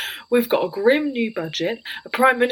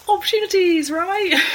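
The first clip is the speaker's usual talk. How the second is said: strained cheer; 10 semitones higher than usual. Acted. Forced optimism cracking.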